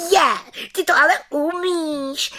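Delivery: high, loud delighted cry; delighted children. A narrator's reading. Children delight at the witch's magic, high and loud. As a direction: marvel with childish joy. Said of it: "radovaly se děti".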